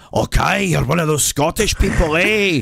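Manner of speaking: high, loud mock Scottish; shrieky parody of a Scottish accent; terrible Scottish accent. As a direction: imitate someone hamming up bad Scots. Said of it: "giving me their terrible Scottish accents".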